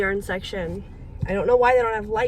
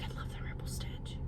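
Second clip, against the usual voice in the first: much softer unvoiced whisper, delighted; 15 dB quieter than usual. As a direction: whisper your excitement like a secret. Excited shared craft secret.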